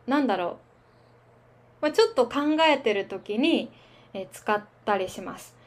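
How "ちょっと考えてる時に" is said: ordinary talk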